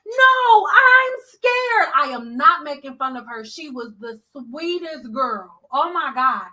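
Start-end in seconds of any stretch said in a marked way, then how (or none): 0.1-1.9 put-on high, loud frightened childlike cries; shrill-pitched scared whimpering plea, imitated; imitating scared daughter. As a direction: mimic a panicked grown woman calling for mommy. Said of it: "Her daughter was at the bowl like this".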